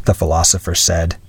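ordinary delivery

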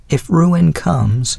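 a hint of the old widow's voice; elderly lady voice. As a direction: speak as a kindly older woman. Mrs Callender begins.

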